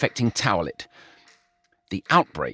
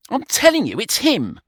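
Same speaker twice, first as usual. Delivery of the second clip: much higher, louder, emphatic; insisting; 12 semitones higher, 4 dB louder than usual. A narrator's reading. George insisting to Brian, emphatic. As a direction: press the point hard. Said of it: "George insisted".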